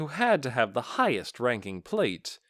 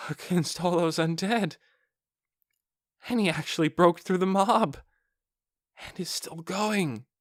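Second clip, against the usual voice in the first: higher, amazed guard voice; a stunned soldier, half-whispering his awe; awestruck guard; 6 semitones higher than usual. Acted.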